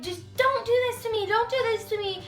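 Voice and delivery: high-pitched imitation of frantic begging; mock screaming plea. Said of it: "he's, like, screaming".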